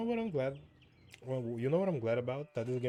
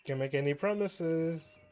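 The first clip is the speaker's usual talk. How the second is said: cheeky; 6 semitones higher, 5 dB louder, slower than usual. Acted. Cheeky reply to priest.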